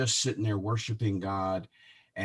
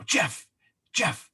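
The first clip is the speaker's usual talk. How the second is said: excited call; 8 semitones higher than usual. Retold or imitated. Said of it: "all excited like".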